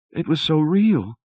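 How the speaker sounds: high, shaken and breathless. A narrator's reading. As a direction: still trembling from the dream. Wife still shaken.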